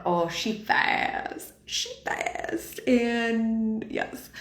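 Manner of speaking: breathy awed whisper about a fast Jeep. Acted. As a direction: hush it out as if the car is too thrilling to say aloud.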